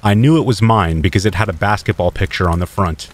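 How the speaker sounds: plain reading voice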